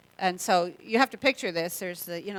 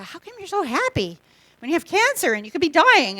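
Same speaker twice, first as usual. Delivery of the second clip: higher, put-on puzzled voice; incredulous patients; 8 semitones higher, 6 dB louder than usual. Patients on the chemo ward, mildly incredulous. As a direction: lightly voice a baffled fellow patient. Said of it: "people would go".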